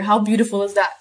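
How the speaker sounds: ordinary delivery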